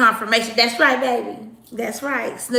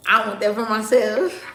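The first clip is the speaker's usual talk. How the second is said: usual speaking voice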